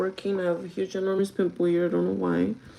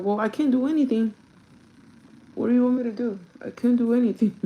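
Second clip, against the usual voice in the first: raised, louder, fed-up protest; a higher, louder mock of your own helpless reply; exasperated retort; 5 semitones higher, 6 dB louder than usual. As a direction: throw up your hands at him. Retold exasperated reply to coworker.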